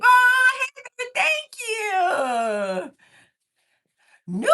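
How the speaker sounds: very high, loud gushing thanks; delighted squeal. Real, not acted. Thanking a viewer who likes her vibe, squealing delight.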